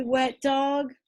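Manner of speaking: usual speaking voice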